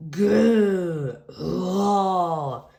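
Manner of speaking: put-on playful toddler roar; dinosaur growl. A narrator's reading. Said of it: "growls George".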